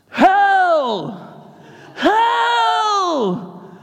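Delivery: high, loud drawn-out shouts; shouted hell. Retold or imitated. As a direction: yell it from the pulpit three times. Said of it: "I shouted it".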